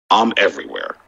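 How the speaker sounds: low, menacing villain line. Real, not acted. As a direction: say it like a movie villain. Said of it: "why you sound like a villain?".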